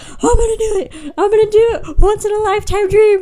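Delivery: loud, high-pitched hyped yelling; imitated frantic excitement. Little sister about to beat her brother, imitated excited yelling. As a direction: cheer yourself on at full pitch. Retold frantic button-mashing near victory.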